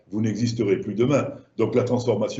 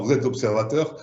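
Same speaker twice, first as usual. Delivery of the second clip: ordinary talk, nothing marked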